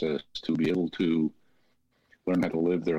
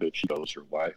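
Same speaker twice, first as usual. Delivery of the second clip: normal talking voice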